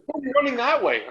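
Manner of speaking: high incredulous question through laughter; a high, laughing why-are-you-running; laughing disbelief. Retold or imitated. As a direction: laugh while you ask it. Laughing at Rhino running wrongly.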